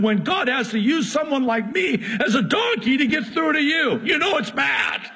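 high, hammed-up talking donkey pleading its case; indignant donkey voice. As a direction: play the donkey as an offended messenger, building to a shout. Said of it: "that was a little bit extreme".

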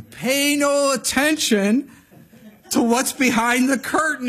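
high, loud, drawn-out proclamation; slow, grandly hammed-up command; Wizard of Oz. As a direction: boom it out like a fake great wizard. Booming Wizard of Oz quote.

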